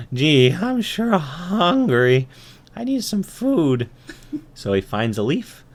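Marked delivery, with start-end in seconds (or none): 0.1-3.9 high, drawn-out ant voice; hungry young ant. Acted. Ant wanting food on his walk, high slow voice. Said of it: "and he says".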